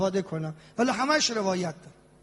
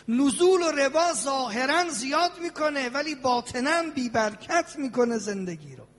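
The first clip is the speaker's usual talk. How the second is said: ordinary talk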